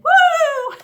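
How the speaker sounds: high, loud joyful woo; whoop. Real, not acted. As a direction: let out a whoop of delight. Ecstatic laughter exercise.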